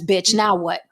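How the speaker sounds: normal talking voice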